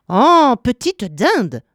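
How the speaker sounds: high, drawn-out scornful swoop; mock her with a long, swooping put-down; exasperated witch. A narrator's reading. Witch scorning Gretel's ignorance, exasperated.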